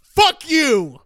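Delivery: loud, high acted yell; defiant outburst. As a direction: reenact yelling at the TV. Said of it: "I stand up and I say".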